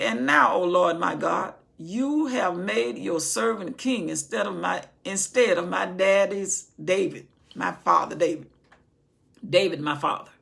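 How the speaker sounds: booming sermon delivery; preaching Solomon's prayer. Acted. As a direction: call it out like a pulpit prayer.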